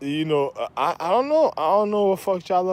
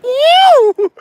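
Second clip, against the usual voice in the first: very high, loud "woo"; 8 dB louder than usual. Retold or imitated. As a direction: imitate a pop star's piercing falsetto whoop. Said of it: "[name] used to be on top. He's like".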